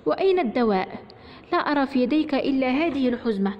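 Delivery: plain reading voice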